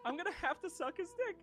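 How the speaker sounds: high, soft squeak of comic dread; hushed mock panic. Alone with Gabe in the elevator, high hushed mock panic. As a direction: repeat it small and high, as a horrified realisation.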